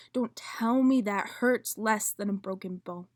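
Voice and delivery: loud, intense slam poem delivery; spoken word poetry. Acted. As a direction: perform it like a slam poet on stage.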